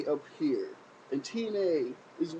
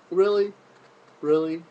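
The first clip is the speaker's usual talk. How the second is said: loud disbelieving really, much louder; incredulous; 2 semitones higher, 9 dB louder than usual. Real, not acted. Vlogger disbelieving Triple H cried on TV, loud incredulous yell. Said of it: "incredulous outburst".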